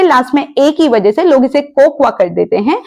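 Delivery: usual speaking voice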